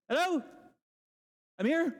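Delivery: high, tentative call-out; calling at the door. Acted. Peter outside a locked door, calling out high. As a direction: call out to be let in, pitched well up.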